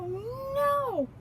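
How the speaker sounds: high, cried-out oh no; dismayed oh no. Acted. Button pops, dismay.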